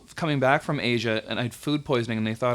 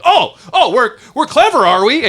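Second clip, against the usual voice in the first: much higher and louder sarcastic taunt; mocking officer; 11 semitones higher, 9 dB louder than usual. TSA guy mocking the sweaty traveller, loud sarcastic taunt. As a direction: sneer it up high like a smug bully.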